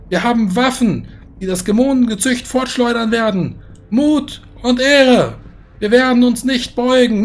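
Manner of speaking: booming voice over the battlefield; magically amplified voice. Acted. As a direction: proclaim it across the whole army. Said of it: "eine magisch verstärkte Stimme klingt".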